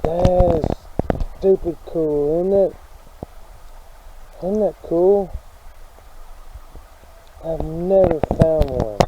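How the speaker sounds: real thrilled outburst, words stretched; excited. Real, not acted. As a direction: marvel at a thrilling find. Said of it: "I'm excited as crap".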